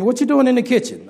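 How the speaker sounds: wife's challenge. Retold or imitated. Wife catching husband in the kitchen, high loud challenge. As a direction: demand it like the wife.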